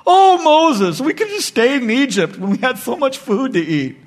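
a touch of a sulky crowd; mock whining Israelites. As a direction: ham up the complaining people longing for Egypt. Mocking grumbling Israelites.

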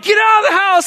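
high-pitched frantic order; panicked yell. Retold or imitated. Husband warning his wife about a snake, high panicked yell. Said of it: "I yelled to my wife".

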